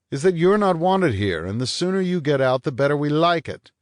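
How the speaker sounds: angry order. Acted. Vanier tells the detective to get out, loud and hostile. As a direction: throw him out with words.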